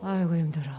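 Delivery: tired worker sighing; weary sigh demo. Shown as a demonstration. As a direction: sigh it out like you're drained. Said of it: "한번 해보세요".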